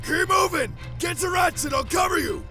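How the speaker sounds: shouted high over the fight; barked orders. Acted. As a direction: bark urgent orders to the group. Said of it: "Barking out these orders".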